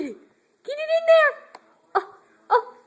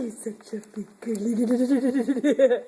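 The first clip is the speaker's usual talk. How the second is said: low slow coo; 6 semitones lower, slower than usual. Real, not acted. Cooing praise to baby.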